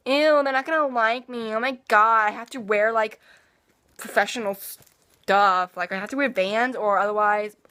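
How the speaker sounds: mock fussy schoolgirl worrying about image; imitating AJ. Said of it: "She was like that".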